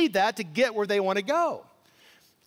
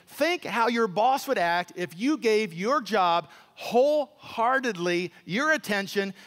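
normal talking voice

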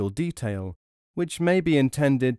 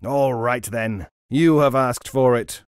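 slow, then loud and menacing; louder angry roar; roaring robber; 6 dB louder than usual. A narrator's reading. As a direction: lose patience and threaten. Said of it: "roared the robber".

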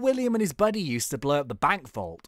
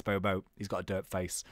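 normal talking voice

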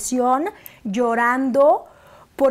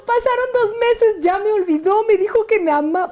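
high, louder heartbroken complaint; distraught; 9 semitones higher, 3 dB louder than usual. Retold or imitated. Reliving finding out the ex moved on, high distraught complaint. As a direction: wail it out as if betrayed. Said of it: "Y yo".

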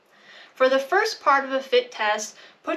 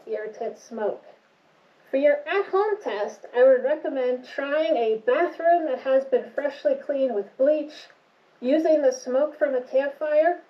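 muffled behind face mask; speaking inside respirator; through a respirator mask. Real, not acted. Seen 1: Twin-cartridge respirator covers nose and mouth throughout; R frames show it held, not worn.

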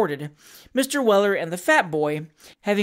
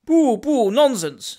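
louder, higher pooh-poohing; dismissive; 4 semitones higher, 4 dB louder, slower than usual. A narrator's reading. Friend urging gentleman to slide, briskly dismissive. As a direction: brush the excuse aside heartily.

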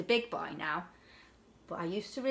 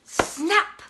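loud snap; 9 semitones higher, 8 dB louder than usual. Acted. Telling children how the big fish snaps, loud cry. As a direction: shout the snap high and loud.

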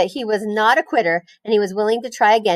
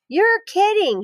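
high astonished squeal of disbelief; surprised; 12 semitones higher, slower than usual. Acted. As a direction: gasp it out in amazement. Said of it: "he said".

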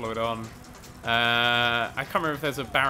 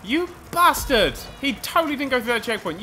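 indignant outrage; 9 semitones higher than usual. Real, not acted. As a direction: stretch it out in disbelief. Rival skipped checkpoint.

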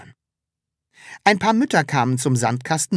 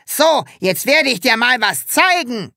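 much higher, loud boastful yell; Hubert shouting; 10 semitones higher, 5 dB louder than usual. A narrator's reading. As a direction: shout a challenge. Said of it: "schrie er".

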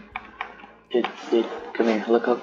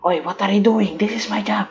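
high squeaky voice; 11 semitones higher, faster than usual. Pretend. Mock protest in a high voice.

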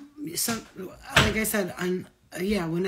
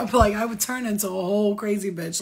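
normal talking voice

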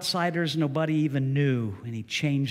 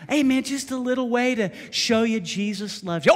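higher mock offer; 9 semitones higher, 5 dB louder than usual. Shown as a demonstration. Repeating line with mock fight.

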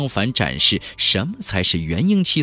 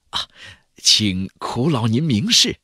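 ordinary delivery

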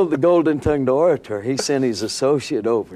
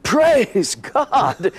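higher, louder joyful shout of Praise God; retold yell; 11 semitones higher, 3 dB louder than usual. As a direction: burst out praising, pitched high. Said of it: "And I yelled".

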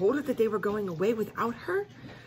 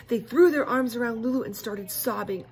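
ordinary delivery, nothing marked

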